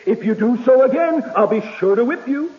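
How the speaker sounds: lightly voiced mother pressing the threat harder; increased emphasis. Mother repeating her threat to the child, mildly emphatic. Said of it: "she says with increased emphasis".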